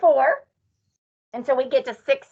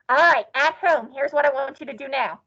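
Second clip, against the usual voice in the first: usual speaking voice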